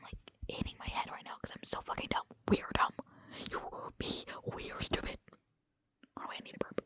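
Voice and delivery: ordinary delivery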